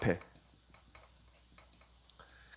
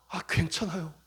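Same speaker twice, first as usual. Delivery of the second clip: reassuring; 7 dB quieter than usual. Real, not acted. 목사의 위로.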